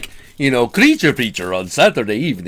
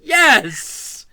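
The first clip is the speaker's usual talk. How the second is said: high, louder mock exasperation; high, loud playful put-down; teasing scorn; 14 semitones higher, 7 dB louder, much slower than usual. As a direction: tease him for being an idiot. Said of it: "She's like".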